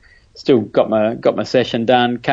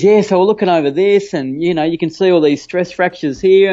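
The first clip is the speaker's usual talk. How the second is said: higher, eager explaining voice; keen doctor pointing things out; imitating an excited doctor showing stress fractures; 7 semitones higher, 3 dB louder than usual. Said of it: "all excited".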